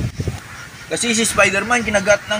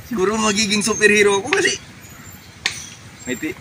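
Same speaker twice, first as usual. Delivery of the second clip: usual speaking voice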